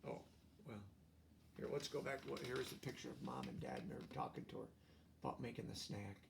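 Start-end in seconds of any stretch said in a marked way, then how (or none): none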